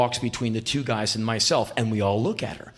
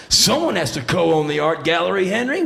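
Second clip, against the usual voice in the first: loud showman rising high on the name; 4 semitones higher, 5 dB louder than usual. Retold or imitated. Rock singer explaining himself to [name], loud swaggering voice. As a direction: toss it off with rock-star swagger.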